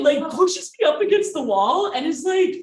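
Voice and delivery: usual speaking voice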